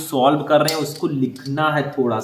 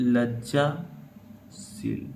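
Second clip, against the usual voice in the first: very slow, softer, drawn-out word; writing-pace dictation; 3 semitones lower, 8 dB quieter, much slower than usual. Real, not acted. Teacher writing the answer for students, slow dictation. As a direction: stretch it out softly as you write.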